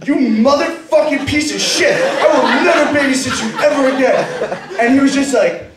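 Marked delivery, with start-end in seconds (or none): 0.1-4.2 higher-pitched, indignant cursing; outraged. Acted. Babysitter reacts to the daughter's trick, outraged. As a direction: swear off the child in comic fury.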